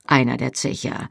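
usual speaking voice

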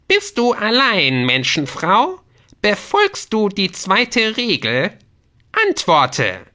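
high, loud, drawn-out gnome demand; interrogating gnome. Acted. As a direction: grill them as a shrill, bossy gnome. (herrisch fragender Gnom mit hoher Stimme)

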